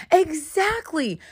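high, drawn-out agreement; retold slow, pointed confirmation; emphatic agreement. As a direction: stretch it out as if the point is finally landing. Acted agreement to friend's admission.

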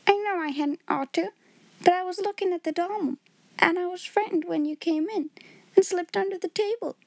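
high, timid maid voice; a frightened little servant girl pleading; Becky's voice. A narrator's reading. Becky pleading with Miss Minchin.

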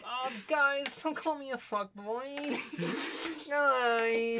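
high whiny character voice; Dendron voice. Acted. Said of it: "Dendron speaks up".

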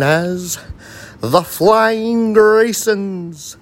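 booming circus announcer, building up; ringmaster. Acted. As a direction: announce the act to the big top.

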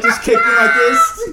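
high, loud, cracking laugh-talk; laughing hard. Real, not acted. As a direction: squeal it through helpless laughter.

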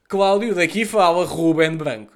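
loud, slow, mock-formal announcement; grand announcer intro. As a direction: introduce yourself like a radio host. Formal phone introduction to the kid. (apresentação pomposa e lenta)

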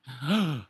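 quick shocked gasp; mock gasp. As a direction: gasp as if startled. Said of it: "not like".

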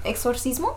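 Spanish vowels for Exorcismo?, stress on -cis-. Shown as a demonstration. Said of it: "how do you say it in Spanish?".

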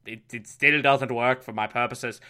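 put-on low Irish-Scottish muddle of an accent; botched Irish accent. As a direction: attempt an Irish accent that keeps sliding into Scottish. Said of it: "this is not an Irish accent".

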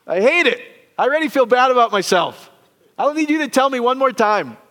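loud, high whiny complaint; whiny kid. Retold or imitated. Pastor voicing his teen self, loud high whine.

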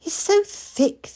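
put-on high, eager small boy; little boy voice. Mikey asking his sister, high and eager. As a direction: ask it wide-eyed like a small child.

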